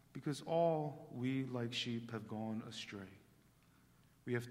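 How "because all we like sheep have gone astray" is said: ordinary talk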